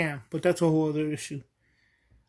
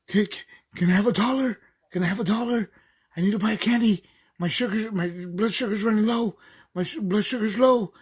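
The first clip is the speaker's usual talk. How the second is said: put-on higher, pleading voice of an older lady begging; retelling a woman begging a dollar, higher and pleading; imitating breathless lady; 4 semitones higher than usual. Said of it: "she's hyperventilating".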